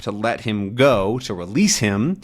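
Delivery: usual speaking voice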